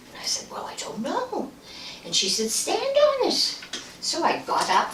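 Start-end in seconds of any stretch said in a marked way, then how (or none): 0.5-1.5 breathy frightened whisper, barely voiced; scared whisper. Answering Helen about the mound, a nervous whisper. 2.5-3.6 much higher, slow voiced old lady; Helen's voice. Helen telling the narrator to test the mound, high slow old-lady voice. Helen gives a firm order.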